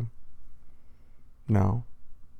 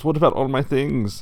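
put-on higher, louder German accent; German-accented pig; 5 semitones higher, 7 dB louder than usual. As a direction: plead in a raised German accent. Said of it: "but he's German".

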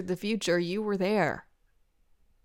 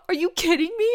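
much higher, amazed question; thrilled disbelief; 8 semitones higher, 4 dB louder, faster than usual. Acted. Friend hearing he loves her, high amazed disbelief. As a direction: squeal it in disbelief. Overjoyed at love confession.

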